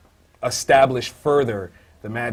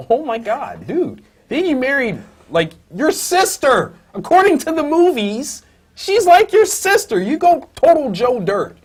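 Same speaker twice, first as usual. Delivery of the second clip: excited rant; 13 semitones higher, 7 dB louder than usual. Real, not acted. Ranting about Professor X.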